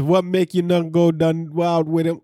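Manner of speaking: put-on halting go at a Jamaican accent; attempted Jamaican patois. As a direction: read it out in a rough Jamaican patois. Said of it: "Jamaicans type in patois".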